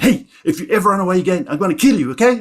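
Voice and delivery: fast, high, loud warning; threatening mum. Retold or imitated. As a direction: lay down the threat as an angry mother. Mum threatens child in story.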